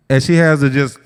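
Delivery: usual speaking voice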